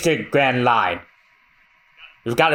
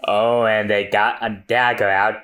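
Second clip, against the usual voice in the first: ordinary delivery, nothing marked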